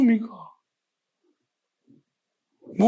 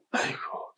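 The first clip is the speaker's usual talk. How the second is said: whispered sigh; 14 dB quieter, much slower than usual. Real, not acted. Sighing lament at the critics.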